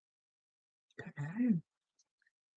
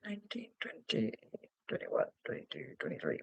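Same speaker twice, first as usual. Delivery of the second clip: whispered counting; 3 semitones lower, 18 dB quieter than usual. Real, not acted. Counting stitches under her breath.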